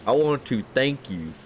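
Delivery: ordinary talk, nothing marked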